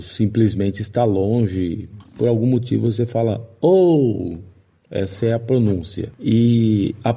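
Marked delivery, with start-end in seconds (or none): 3.6-4.4 Oh said higher, like calling a stranger far off. Shown as a demonstration. Teacher shows long O, like calling someone far off. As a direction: call out Oh to someone far away. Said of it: "quando você está chamando alguém que você não conhece".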